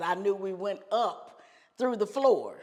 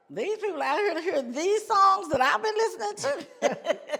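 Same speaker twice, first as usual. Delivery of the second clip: high-pitched comic disbelief; hammed-up astonishment, close to laughing; incredulous; 10 semitones higher, 3 dB louder than usual. Disbelief at crowd's taste, retold.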